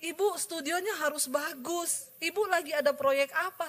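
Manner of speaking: high, urgent weepy pleading; tearful caller. Retold or imitated. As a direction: imitate an overwrought, grateful caller. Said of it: "ada yang telepon nangis-nangis".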